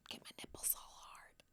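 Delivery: barely-there naughty whisper; hushed, secret aside. Acted. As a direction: whisper it like a private confession. Whispered aside after acted reaction.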